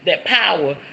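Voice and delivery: usual speaking voice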